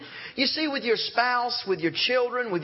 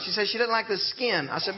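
normal talking voice

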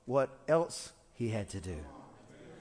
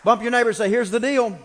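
calling out; 7 semitones higher, 10 dB louder than usual. Real, not acted. Congregation call and response.

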